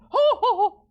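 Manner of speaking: mock jolly laugh. Joking exaggerated vocal noises.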